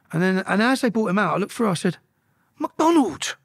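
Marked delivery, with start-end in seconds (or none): 2.6-3.3 high-pitched startled call; retold calling out. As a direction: call the name across the cells. Calling to man in next cell.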